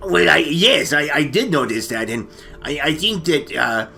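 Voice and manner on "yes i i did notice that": ordinary talk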